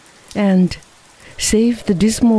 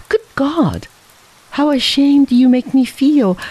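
ashamed outburst; 8 semitones higher than usual. Acted.

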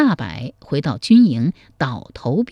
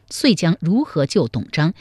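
usual speaking voice

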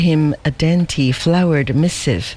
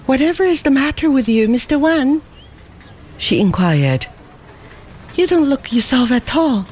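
high, fast worried questioning; a young woman pressing him anxiously; concerned girl; 8 semitones higher than usual. Acted. Ada presses Lin Fo, high and worried.

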